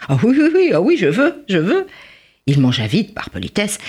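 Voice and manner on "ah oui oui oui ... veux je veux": high, louder greedy rush; eager yes. A narrator's reading. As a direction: jump at the offer. Chang greedy, accepting.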